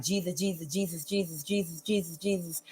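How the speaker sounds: soft, hushed repeated Jesus, a mother's quiet prayer; murmured prayer. Retold or imitated. Imitating mother praying on her knees, soft murmur. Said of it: "I'll just keep saying Jesus over and over".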